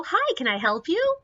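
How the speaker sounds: high, chirpy customer-service "Hi, can I help you?"; high, bright and polite. Retold or imitated. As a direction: sing it out sweetly to move him along. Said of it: "break off the story and go".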